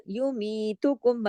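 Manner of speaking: युमीतुकुम with the mi drawn out two counts; stretched mi. Shown as a demonstration. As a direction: lengthen मी in युमीतुकुम. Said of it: "मद्दा के जो हरूफ होते हैं ना".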